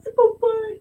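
put-on high, crying little-girl wail; mock child crying. Daughter crying to her dad about her mistake, tearful wail. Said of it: "começou a chorar".

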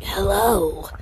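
mock silly hello; lightly voiced weird greeting. Shown as a demonstration. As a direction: say hello in a funny put-on voice. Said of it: "you talk in weird voices".

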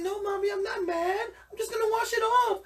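put-on high little-girl voice, trying to sound fine; brave little girl. Little girl reassuring her mom, high and bravely. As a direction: squeak it like a scared kid being brave. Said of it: "She's like".

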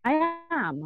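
stretched high stress on AM; emphatic concession. Real, not acted. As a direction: AM pushed up high and drawn out.

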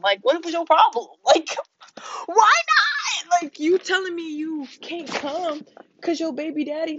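high, exasperated ranting at the friend; venting, voice climbing in disbelief; heated rant. Retold or imitated. Ranting at the friend who kept her phone, exasperated and high.

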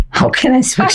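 squeaky helium voice, laughing. Real, not acted. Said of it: "I just switched the pitch".

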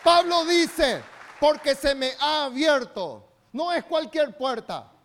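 loud, high, slow sermon shout; preaching at full force. Real, not acted. Pastor preaching to the church, still at full force. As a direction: keep the revival fire going.

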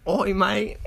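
hammed-up Australian; Australian accent. Shown as a demonstration. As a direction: put on a cheeky Australian accent. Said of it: "It's always Australian".

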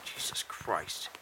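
slow breathy whisper. Real, not acted. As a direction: sigh it out in a whisper. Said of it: "Why are we whispering?".

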